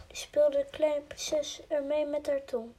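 usual speaking voice

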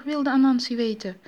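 ordinary delivery

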